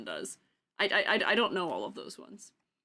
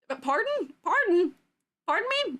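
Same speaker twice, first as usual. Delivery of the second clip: surprised; 9 semitones higher than usual. Real, not acted. Startled by chat flood.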